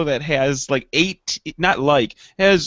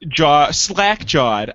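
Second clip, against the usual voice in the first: slow; 2 semitones lower, much slower than usual. Real, not acted. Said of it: "I think is the word".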